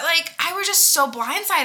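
usual speaking voice

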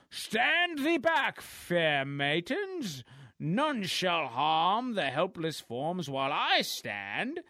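slow, hammed-up heroic proclamation; mock-Shakespearean superhero decree; pompous lifeguard hero. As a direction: declaim it grandly, drawing out every word. Voicing the heroic lifeguard again.

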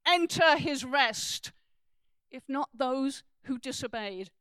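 forceful scripture reading. Acted. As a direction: thunder the questions, then drop away. Reads Hebrews passage with force.

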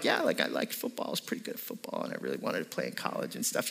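hushed earnest recollection, barely voiced; whispered aside. Retelling his earnest sermon remark.

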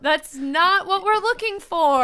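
high, emphatic imagined put-down; exasperated retort. Retold or imitated. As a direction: stretch it out in mock protest. Retorting to imagined attention-grabbing speakers.

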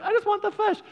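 high-pitched mock little-kid whine; pleading child. Little kid begging for fish.

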